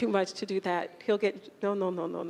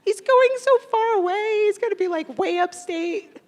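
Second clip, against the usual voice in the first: fighting back tears as he leaves; choking up; 9 semitones higher, 6 dB louder than usual. Real, not acted. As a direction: voice rising high and tight, near tears. Teacher tearing up about student leaving.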